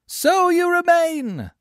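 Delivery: high, slow, loud affirmation; crowd cry. A narrator's reading. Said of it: "all the citizens cry".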